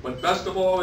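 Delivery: put-on storyteller's light take on an old Italian husband; lightly voiced Giuseppe. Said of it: "Giuseppe replied to the assembled husbands".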